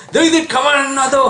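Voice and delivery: high-pitched acted threat; angry God. Preacher voicing God coming at the enemies, angry. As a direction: threaten them as a wrathful protector. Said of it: "하나님 막 급하게 화내가지고".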